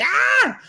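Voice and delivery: sudden high squeal of delight; high-pitched fangirl squeal; excited shriek. Retold or imitated. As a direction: squeal like a starstruck fan. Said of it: "I was like".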